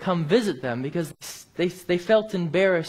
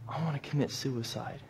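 hushed, lower retold confession; melted anger; 4 semitones lower, 11 dB quieter than usual. Broken man confesses to Bible worker before crying, hushed retelling. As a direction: drop right down, soft and low, as anger gives way. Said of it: "his angry face just melted".

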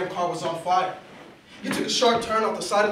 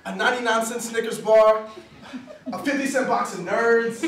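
usual speaking voice

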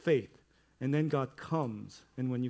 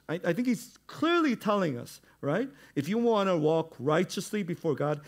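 much higher and louder, impassioned preaching; preaching at full force; 4 semitones higher, 6 dB louder than usual. Acted. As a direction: drive the point home to the congregation. Impassioned sermon close.

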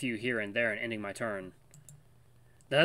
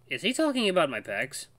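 usual speaking voice

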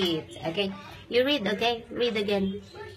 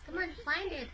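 ordinary talk, nothing marked

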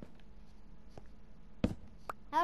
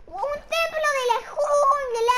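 loud, higher cry of delight; excited shout; 6 semitones higher, 7 dB louder than usual. Real, not acted. Player telling viewers he found a jungle temple, excited shout. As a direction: shout the discovery proudly.

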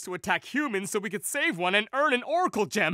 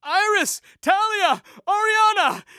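shouted calls, each name higher and louder; calling out names; 10 semitones higher, 4 dB louder than usual. Acted. Villain boy calling for the three girls, loud shouts. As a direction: yell for them across a distance.

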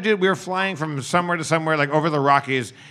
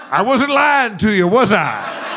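higher, slow, loud with a laugh through it; laughing pilot; 6 semitones higher, 8 dB louder, slower than usual. Retold or imitated. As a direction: chuckle as the pilot teasing his passengers. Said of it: "he kept laughing".

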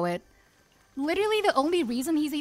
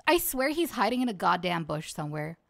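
ordinary delivery

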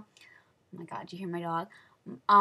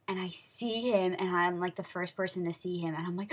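usual speaking voice

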